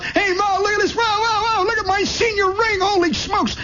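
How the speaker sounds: put-on very high kid yelling; high-pitched boyish hollering; excited kid. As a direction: burst in bragging like an excited boy. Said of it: "Now I'm a kid again".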